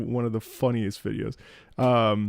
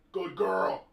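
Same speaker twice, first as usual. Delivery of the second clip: dog praise; 5 semitones higher, 5 dB quieter than usual. Real, not acted.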